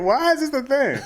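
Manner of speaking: high, exasperated mock question; mock bewilderment. Reacting to being called out for perping, high mock bewilderment. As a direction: protest in high-pitched disbelief.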